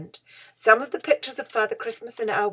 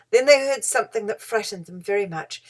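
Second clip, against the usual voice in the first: usual speaking voice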